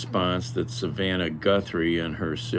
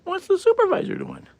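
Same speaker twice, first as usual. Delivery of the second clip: high-pitched pet talk, louder on the second line; 5 dB louder, faster than usual. Real, not acted. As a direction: coo at the cat in a tiny high voice. Talking to his cat.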